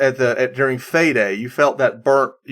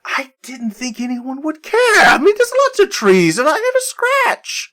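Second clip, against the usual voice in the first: high, whiny in-character excuse; defensive protest; 13 semitones higher than usual. Acted. Mari excusing her scratching on the tree, defensive protest. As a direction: protest with the pitch climbing way up.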